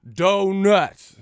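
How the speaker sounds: loud, high, defiant shout; shouted in defiance. Real, not acted. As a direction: yell the forbidden word on purpose. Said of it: "Man, I'ma say this shit".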